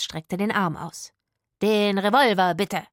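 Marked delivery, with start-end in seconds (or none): 1.6-2.9 called-out demand. A narrator's reading. Rufus demanding the gun from Itzig, called-out demand. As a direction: call for it with command. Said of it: "rief er".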